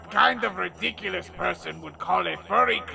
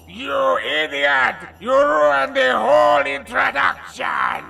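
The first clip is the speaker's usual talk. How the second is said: angry shout; 8 semitones higher, 9 dB louder than usual. Acted. Aku yelling at sidekick.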